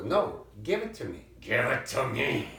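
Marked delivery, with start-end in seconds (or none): 1.4-2.6 repeated through a laugh. Real, not acted. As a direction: ask again, laughing at her.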